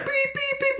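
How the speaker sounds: very high, piercing mimicked beeps; imitated alarm beeping. Fire alarm going off in the room, imitated beeping. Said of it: "like, full on beeping".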